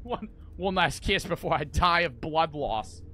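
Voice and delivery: hammed-up Australian lover; mock Australian accent. Gamer as Nick begging babes for a kiss, mock Australian accent. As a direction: plead jokingly in an Australian accent. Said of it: "he's Australian when I hear him talk".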